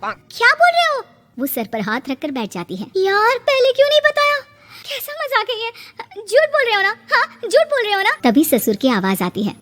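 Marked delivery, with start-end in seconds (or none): 0.0-1.0 high shocked cry. Acted. Bride shocked at news. 3.0-8.1 disbelief. Acted. Bride reacting in disbelief.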